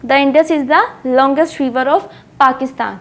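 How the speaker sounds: slow, word by word, like dictation; slow example sentence. Shown as a demonstration. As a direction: read the example out slowly for students. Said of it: "किसकी comparison कर दी?".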